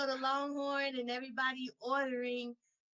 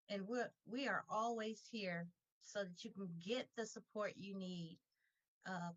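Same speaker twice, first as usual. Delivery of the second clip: talking low to stay calm; 2 semitones lower, 7 dB quieter than usual. Real, not acted. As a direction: keep it low and steady to hold in excitement. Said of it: "I'm talking low 'cause I'm trying to stay calm".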